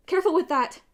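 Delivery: high, loud warning. A narrator's reading. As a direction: warn them sharply. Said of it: "Annabeth warned".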